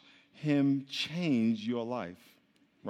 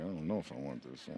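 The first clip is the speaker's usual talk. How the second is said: low, quiet, mock reluctance; reluctant murmur; 12 semitones lower, 14 dB quieter than usual. Some of y'all are like this, reluctant and hushed. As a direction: voice their doubt softly and low.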